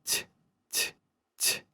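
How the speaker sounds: "т" breathed out with a faint "i" after it; "т, т, т" as whispered soft t. Shown as a demonstration. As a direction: whisper "т" softly. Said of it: "Let's just try to whisper this I together".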